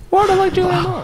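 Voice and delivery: very high, squeaky mock voice; mock whine. Teasing co-host about Julianne Moore, high mocking voice.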